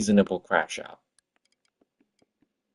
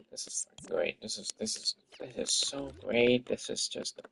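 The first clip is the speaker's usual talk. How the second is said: whispered, sarcastic disbelief; whispered sarcasm; 9 dB quieter than usual. Real, not acted. A dev whispering at his broken build, sarcastic and defeated. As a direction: hush it out like you can't believe it.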